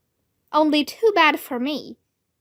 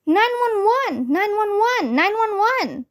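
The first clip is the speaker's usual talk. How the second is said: hollering; 8 semitones higher than usual. A narrator's reading. Girl who lost her mittens, panicked hollering. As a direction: holler for help like an emergency. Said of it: "I hollered".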